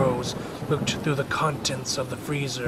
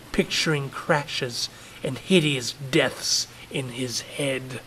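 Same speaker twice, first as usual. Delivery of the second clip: usual speaking voice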